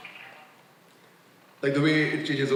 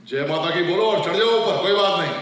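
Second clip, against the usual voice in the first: rallying cheer; 3 semitones higher, 5 dB louder, much faster than usual. Retold or imitated. Rallying friends to drive on.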